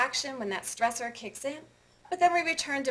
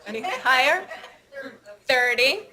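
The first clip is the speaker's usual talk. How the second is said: auctioneer; 6 dB louder than usual. Pretend. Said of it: "I feel like an auctioneer".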